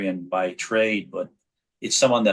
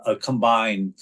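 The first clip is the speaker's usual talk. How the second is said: ordinary delivery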